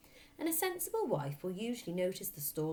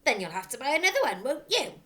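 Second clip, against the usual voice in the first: loud, fast sneering taunt; mocking father; 7 semitones higher, 11 dB louder, faster than usual. A narrator's reading. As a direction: taunt her with nasty mock sweetness. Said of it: "the father said".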